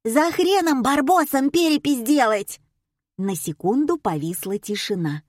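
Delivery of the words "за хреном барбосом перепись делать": high squawking, scolding wife; screechy contralto. A narrator's reading. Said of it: "визгливое контральто". (визгливая сварливая жена)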